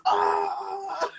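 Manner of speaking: high screaming yelp; imitated shriek. Friend screaming again when he calls back. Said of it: "All you hear is".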